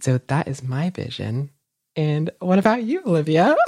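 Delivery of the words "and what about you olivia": laughing. Real, not acted. Turning question back, laughing.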